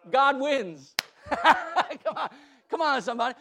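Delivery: loud, laughing triumph; gleeful loud laughing exclamation. Real, not acted. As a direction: laugh through the victory line.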